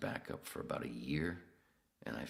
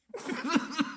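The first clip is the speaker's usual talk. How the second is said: loud high laugh; 7 dB louder than usual. Real, not acted. Laughing hard at the producer's shocked reaction, loud high laugh.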